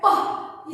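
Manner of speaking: प as a voiceless puff of air; puffed p sound. Shown as a demonstration. Teacher showing kids the breathy p puff. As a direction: pop प with just breath, no voice. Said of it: "वैसे ही प का sound निकलता है".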